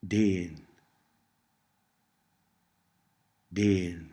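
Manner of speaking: déan with a long, continental e. Shown as a demonstration. Tutor models Cornish for learners, déan with long e. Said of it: "Listen to the pronunciation and notice".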